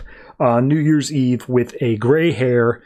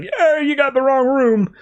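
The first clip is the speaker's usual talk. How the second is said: higher put-on voice for a confused old man; 11 semitones higher than usual. Old man telling Harley she's wrong, put-on voice. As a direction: play the baffled old man, pitched up. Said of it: "And he's like".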